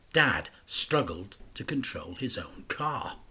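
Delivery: plain reading voice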